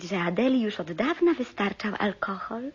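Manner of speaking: ordinary delivery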